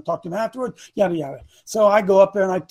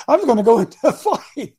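laughing through the words, a bit higher and louder; laughing repeat; 5 semitones higher, 5 dB louder than usual. Retold or imitated. Preacher retelling the warning to the congregation, laughing. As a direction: chuckle as you repeat the warning.

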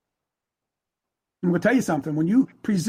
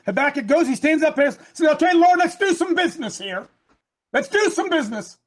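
higher-pitched, emphatic sermon delivery; fervent preaching; 9 semitones higher, 3 dB louder than usual. Real, not acted. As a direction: preach it with rising urgency. Preaches, acting out Habakkuk.

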